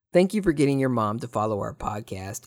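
normal talking voice

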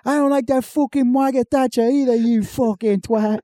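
high, sneering Cockney rant; mock Cockney accent. Parody British contestant cursing Thatcher, mock Cockney accent. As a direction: spit insults like a drunk Brit. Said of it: "Cockney accent".

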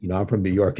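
usual speaking voice

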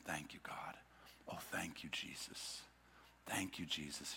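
hushed, breathless whisper of gratitude; whispered thanks. Acted. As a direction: whisper it as if kneeling at his feet. Woman weeping at Jesus' feet.

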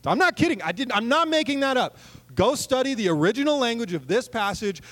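high, animated protest; excited emphasis; emphatic insistence. Real, not acted. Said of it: "insisting to congregation".

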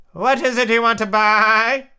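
high, loud, drawn-out bleaty voice; bleating sheep. A narrator's reading. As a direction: bleat the question slowly like a sheep. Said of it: "a long bleat like a sheep".

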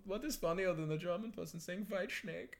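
high, hammed-up German caricature; bad comic German accent. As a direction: chat away in a high, silly German voice. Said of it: "I was in this voice this morning".

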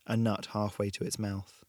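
ordinary delivery, nothing marked

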